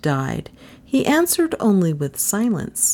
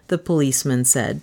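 plain reading voice

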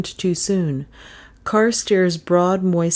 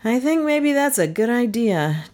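reluctant agreement, pitched up; higher, hesitant assent; 7 semitones higher than usual. A narrator's reading. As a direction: concede it unwillingly. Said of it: "Doan agreed reluctantly".

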